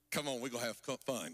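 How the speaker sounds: low, hushed-down suitor voice; coaxing Samson. Acted. Preacher playing Samson at his wife's house, low and coaxing. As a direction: drop deep to coax playfully.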